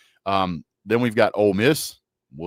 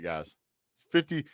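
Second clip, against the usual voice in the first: normal talking voice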